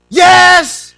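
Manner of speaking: very loud, high, annoyed yell; irritated shout. Acted. Child disturbed by a parent's question, irritated shout. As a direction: shout it like you can't be bothered. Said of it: "I don't wanna be bothered".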